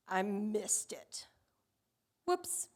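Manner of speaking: whispered, slow mock confession; sheepish whisper. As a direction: breathe it out like a caught kid. Jonah realizing while falling.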